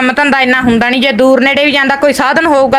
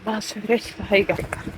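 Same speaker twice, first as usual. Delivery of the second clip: very soft, low, tearful voice; choked up; 3 semitones lower, 14 dB quieter, slower than usual. Real, not acted. Mother asked to speak to viewers, choked up. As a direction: barely get the words out through tears. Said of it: "ਮਨ ਨਾ ਭਰੋ ਭੈਣੇ".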